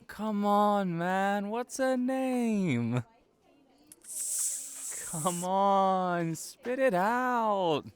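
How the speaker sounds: high, loud, excited prodding; teasing pressure. Real, not acted. Friend pressing a pal for the admirer's name, high teasing prodding. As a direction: drag the name out of him.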